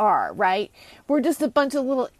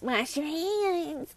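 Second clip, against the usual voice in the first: mocking celebrities; 6 semitones higher, 7 dB quieter, much slower than usual. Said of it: "all these celebrities".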